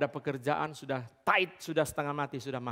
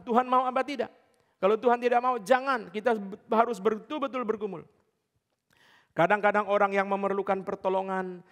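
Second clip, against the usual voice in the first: higher, louder pulpit proclamation; preaching at full force; 8 semitones higher, 4 dB louder than usual. Acted. Preacher drives home that service has standards, fervent. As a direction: hammer each standard home to the congregation.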